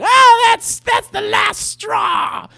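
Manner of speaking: very high, very slow mock indignation; hammed-up final outburst; melodramatic outrage. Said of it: "and she said".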